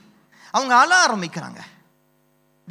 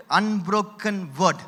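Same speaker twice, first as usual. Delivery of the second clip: ordinary delivery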